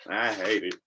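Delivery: normal talking voice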